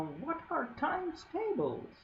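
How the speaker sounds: high, shrill elf shriek. Acted. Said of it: "he would shriek".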